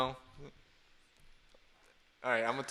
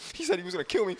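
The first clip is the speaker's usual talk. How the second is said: mock sobbing kid; 6 semitones higher, faster than usual. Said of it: "He's sobbing".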